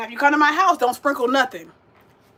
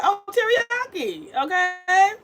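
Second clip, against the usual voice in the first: surprised drawn-out reaction; 7 semitones higher, much slower than usual. Retold or imitated. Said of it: "I'd be like".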